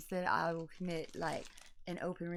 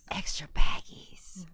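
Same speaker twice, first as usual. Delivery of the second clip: whisper; 10 dB louder, slower than usual. Pretend. Whispered gleeful aside.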